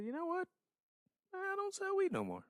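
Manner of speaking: high squeaky panicked voice; mock quitting dealer. Telling how he quit selling after the robbery, high mock voice. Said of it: "I said".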